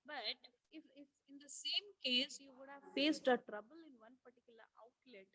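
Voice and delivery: faint, far-off voice; fading out of the mic; barely audible. Real, not acted. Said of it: "Ma'am, your voice is not audible".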